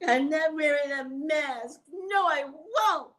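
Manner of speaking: high, slow whiny mock of a rude passenger; mocking stubborn passenger. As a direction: mimic a bratty refusal.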